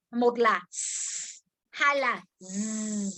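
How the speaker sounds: s said hissed then z buzzed, slowly; slow demo of s then z; s versus z. Shown as a demonstration. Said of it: "phát âm hai cách".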